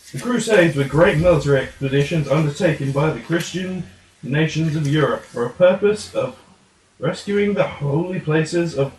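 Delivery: normal talking voice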